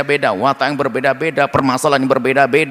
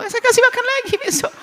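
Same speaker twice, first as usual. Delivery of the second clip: lightly voiced forgiving owner.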